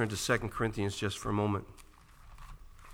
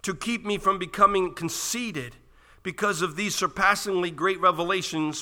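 loud, raised pulpit voice, hammering each item; impassioned scripture reading; 8 semitones higher, 7 dB louder than usual. Real, not acted. Preacher reading Paul's list of hardships to the church, impassioned declaiming. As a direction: declaim the list, climbing in pitch. Reads scripture at full force.

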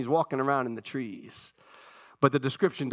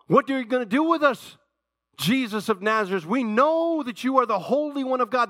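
mild mock cry, a bit louder and higher; demon crying out; 7 semitones higher, 3 dB louder than usual. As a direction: cry out like the possessed man, half-hearted. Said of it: "He cried out".

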